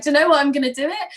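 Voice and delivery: loud, high burst of resolve; fired-up retold vow; excited decision. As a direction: announce it like a big breakthrough. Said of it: "I came home and I was like".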